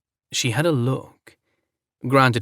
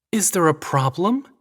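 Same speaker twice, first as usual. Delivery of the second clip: higher, faux-innocent question; too innocent; 8 semitones higher than usual. A narrator's reading. Gwen grabbing her parka, feigning innocence. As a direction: play dumb, sweetly innocent. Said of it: "in a too innocent voice".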